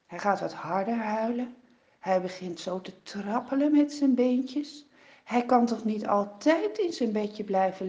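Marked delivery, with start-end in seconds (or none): none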